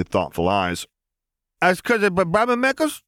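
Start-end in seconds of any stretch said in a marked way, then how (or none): 1.6-3.0 put-on high little boy; toddler voice. A narrator's reading. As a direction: ask like a small child. Ben asks.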